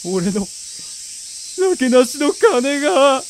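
slow start, high despairing cry; anguished wail. Acted. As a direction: lament your lost last coins. Lost his last money. (嘆きの叫び)

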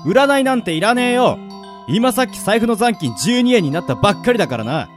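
irritated broke man. Acted. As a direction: brush off the fortune-teller crossly. Irritated hero snaps at fortune-teller. (苛立って突っぱねる)

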